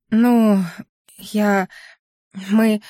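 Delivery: hesitant, with long pauses between words; at a loss. A narrator's reading. Heroine unable to answer whether she stays, faltering. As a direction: falter word by word. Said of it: "Я не знала, что ответить".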